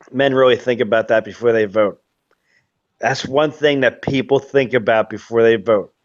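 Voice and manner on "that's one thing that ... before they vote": ordinary talk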